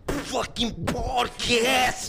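high, slow hammed-up wrestler promo; wrestling promo. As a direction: cut a wild wrestling promo, slobbering. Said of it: "sign out with our best wrestling impression".

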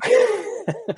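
short burst of real laughter; sudden high laugh. Real, not acted. Laughs after being teased.

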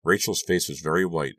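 plain reading voice

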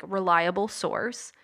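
ordinary talk

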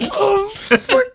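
slow, high, through laughter; laughing. Real, not acted. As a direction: lose it over the name. Cracking up at name.

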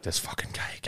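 unvoiced exasperated whisper. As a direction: grumble it under your breath. Waiter mutters under breath.

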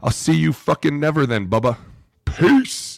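sarcastic breezy goodbye, rising high on peace; tossing off a cocky farewell; mock kiss-off. Dismissive farewell to the coach.

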